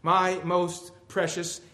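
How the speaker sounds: fervent recitation in God's voice, slowing toward the end; impassioned poem reading. Acted. God answering the weeping believer in verse, fervent recitation.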